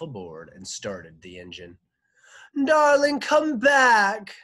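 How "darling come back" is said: high, loud pleading call; husband shouting across the water; shout from afar. A narrator's reading. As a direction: call after your departing wife. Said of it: "Shams shouted from the deck".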